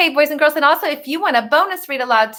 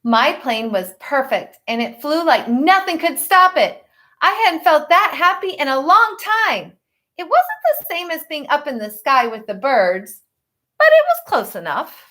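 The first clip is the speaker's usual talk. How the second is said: put-on reading a picture book to children; story narration; 2 semitones higher than usual. A narrator's reading. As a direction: tell it gently to the class. Teacher reads picture book aloud.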